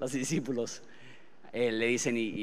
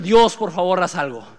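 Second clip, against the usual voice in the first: louder "Dios, por favor" pressed hard; urgent plea; 8 dB louder than usual. Retold or imitated. Preacher urging listeners to cry out to God, loud. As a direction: push the plea out with force.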